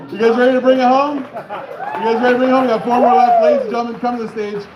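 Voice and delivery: rally the crowd for the next act; hype announcer. Real, not acted. Host brings up the next comic to the crowd, hyped.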